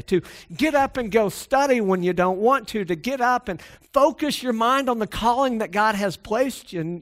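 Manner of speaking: high, driving sermon delivery; impassioned preaching. Real, not acted. Preacher urging students toward God's calling, impassioned. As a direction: press each phrase home with rising fervor. Preaches building to full force.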